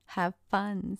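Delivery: usual speaking voice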